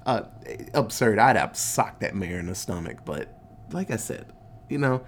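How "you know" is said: ordinary talk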